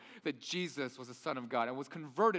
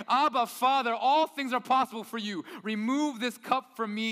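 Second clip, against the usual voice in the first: pleading prayer; 6 semitones higher, 6 dB louder than usual. Acted. Jesus praying in Gethsemane.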